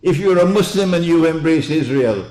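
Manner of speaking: loud, high, fast fiery preaching; preaching at full force. Acted. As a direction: thunder it at the congregation. Impassioned sermon climax.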